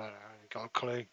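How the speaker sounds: muffled, gated, filtered speech; noise reduction artifacts. Real, not acted. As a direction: voice nearly cut out by the filter. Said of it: "Noise suppression is on".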